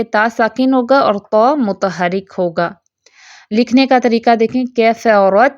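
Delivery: usual speaking voice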